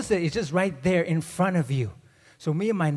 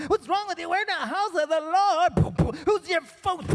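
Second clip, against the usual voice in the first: high, indignant auntie voice; an offended auntie snapping at a kid; scolding auntie; 12 semitones higher than usual. Retold or imitated.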